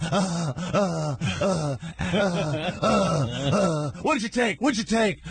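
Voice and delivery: high, stuttering mock panic; panicked stammer. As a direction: act out a man too panicked to get words out. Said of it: "And I went".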